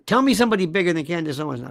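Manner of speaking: ordinary talk, nothing marked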